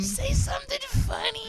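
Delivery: high put-on heckler voice. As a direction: taunt the comic to be funny.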